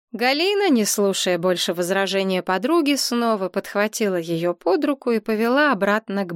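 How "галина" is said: ordinary delivery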